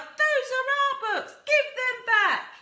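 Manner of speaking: put-on high, loud children's yell, slowing at the end. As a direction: demand the books back at full voice. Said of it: "the children yelled".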